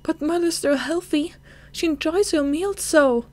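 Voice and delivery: cling to hope against the doctor; desperate pleading. Acted. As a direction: daughter begs doctor that mother will recover.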